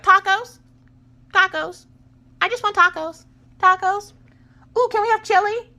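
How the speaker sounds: put-on very high, squeaky little girl; tiny high-pitched kid voice; daughter's voice. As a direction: imitate an eager small child begging. Voices daughter asking for tacos.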